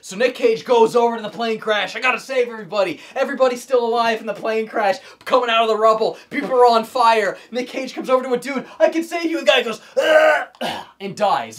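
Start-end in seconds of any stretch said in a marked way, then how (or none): 1.9-7.4 frantic hero. Retold or imitated. Cage voiced saving people. 8.8-9.4 high, loud hammy rescuer; desperate hero. Retold or imitated. Cage voiced rescuing. 10.0-10.8 loud high mock death groan; dying groan.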